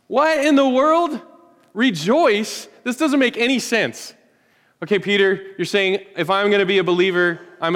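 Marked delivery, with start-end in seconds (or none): 0.1-4.1 higher, slower mock disbelief; "Rejoice?" asked in pitched-up disbelief; incredulous. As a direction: act baffled, as if the idea makes no sense.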